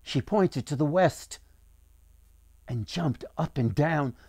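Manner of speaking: ordinary delivery, nothing marked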